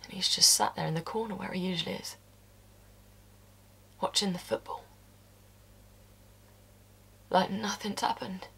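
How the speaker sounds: hushed unvoiced narration; whisper. Seeing the brother-in-law calm, whispered. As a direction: whisper it with disbelief. Describing him in the corner.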